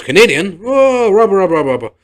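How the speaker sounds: a wedding crowd scandalised all at once; shocked crowd. Retold or imitated. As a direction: guests gasping and muttering over the Canadian. Crowd gasps at the news.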